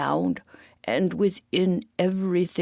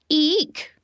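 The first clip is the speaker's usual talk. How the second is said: mock eek; 11 semitones higher, 5 dB louder than usual. A narrator's reading. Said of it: "tongue-in-cheek".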